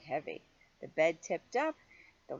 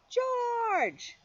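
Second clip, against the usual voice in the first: very high, louder call of the name; 5 dB louder than usual. Acted. The man in the yellow hat calling up to George, high. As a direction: call to him from below. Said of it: "heard someone call".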